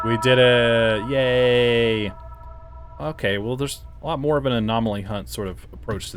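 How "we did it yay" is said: loud triumphant cheering; excited cheer. Real, not acted. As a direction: celebrate a win out loud. Solving the puzzle, celebrating.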